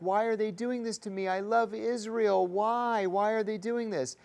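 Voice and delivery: a hammed-up hurt plea; mocking the woman's sobbing; mock crying. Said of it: "She's like".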